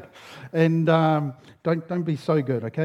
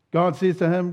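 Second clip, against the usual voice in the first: normal talking voice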